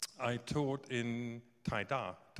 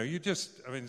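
normal talking voice

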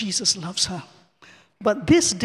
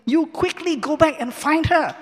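urging pastor; 5 semitones higher, 3 dB louder than usual. Retold or imitated. Pastor urging her to go back.

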